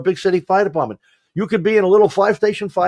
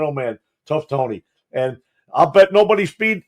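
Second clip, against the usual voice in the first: ordinary talk, nothing marked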